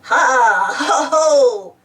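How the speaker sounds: loud, drawn-out cackle; hammed-up villain chuckle; mischievous giggle. A narrator's reading. Spud enjoying teasing Muck, gleeful acted giggle. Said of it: "Spud giggled to himself".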